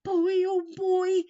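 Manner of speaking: high, loud and slow, savoring the thrill; drawn-out wonder at a shiny motorcycle; excited mouse. Acted. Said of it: "his whiskers quivering with excitement".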